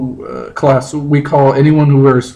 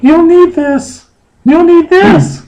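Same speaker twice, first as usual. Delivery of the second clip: very high, louder, slow falsetto; Jenna falsetto; 5 dB louder, slower than usual. Acted. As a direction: squeak it out in a mock girl voice. Reading Jenna's part, falsetto.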